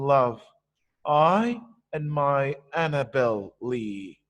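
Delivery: put-on slow beat on "child", "love", "Annabel Lee"; rhythmic verse reading. Shown as a demonstration. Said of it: "stressed syllabled words will be the most clear".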